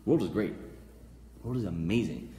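ordinary delivery

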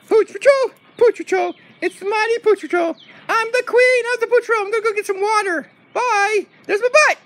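high, piping put-on voice; excited dog queen. Dog queen announces patrol and leaves, high and excited. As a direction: cry it out as a little queen.

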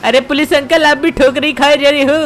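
usual speaking voice

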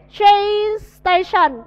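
"Train station" drawn out very slowly and clearly; slow model reading. Shown as a demonstration. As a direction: stretch out "Train station" for the class. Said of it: "teacher models new words". (đọc mẫu "Train station" thật chậm)

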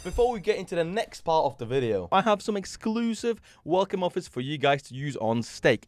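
upbeat sponsor ad voice; ad read. Acted. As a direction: pitch the offer brightly. Sponsor ad read mid-video.

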